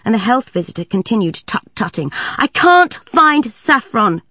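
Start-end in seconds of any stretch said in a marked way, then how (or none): 2.4-4.2 put-on high, slow and sulky child voice; high cross child; cross little girl. A narrator's reading. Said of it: "complained Saffron crossly".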